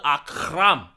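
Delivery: "Akram" with a rasped guttural k; throaty Arabic kh. Shown as a demonstration. Relishing a suspect's Arabic name, "Akram" with a guttural rasped k. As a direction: draw out a throaty scrape inside "Akram". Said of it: "they say the h".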